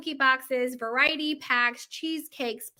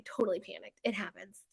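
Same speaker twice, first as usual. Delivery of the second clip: much softer, sheepish admission; quiet aside; 4 semitones lower, 11 dB quieter than usual. Real, not acted. To viewers, owning up to forgetting the number: soft sheepish aside. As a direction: drop the voice, a bit embarrassed. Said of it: "embarrassed aside".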